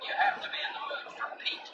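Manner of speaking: ordinary delivery, nothing marked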